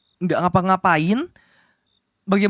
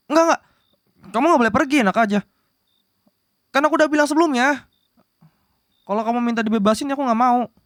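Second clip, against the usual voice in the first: flustered refusal; 5 semitones higher, much faster than usual. Acted. Refuses to let her leave.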